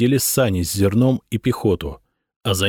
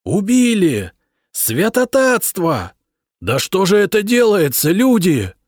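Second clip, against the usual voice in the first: anguished cries; 10 semitones higher, 3 dB louder than usual. A narrator's reading. Armies wail after shooting.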